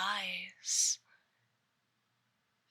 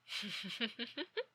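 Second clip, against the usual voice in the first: faint sinister chuckle; 10 dB quieter than usual. Acted. After threatening the victim.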